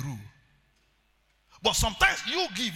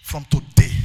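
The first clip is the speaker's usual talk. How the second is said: louder, lower proclamation; loud declaration; 4 semitones lower, 16 dB louder, slower than usual. Acted. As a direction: proclaim it over them. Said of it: "repeated declaration".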